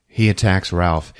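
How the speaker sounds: usual speaking voice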